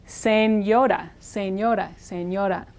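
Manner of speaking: Señora as sen-Yoda, tapped R; sen plus Yoda. Shown as a demonstration. Teacher repeating Señora via Yoda trick. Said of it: "like the character from Star Wars, Yoda".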